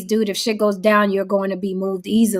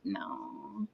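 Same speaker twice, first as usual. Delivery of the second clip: whispered no; 2 semitones lower, 23 dB quieter than usual. Retold or imitated. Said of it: "I'm like".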